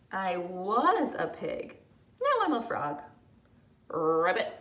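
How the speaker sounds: ordinary delivery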